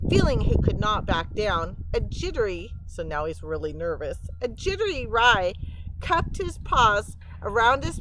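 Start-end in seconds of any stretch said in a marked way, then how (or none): none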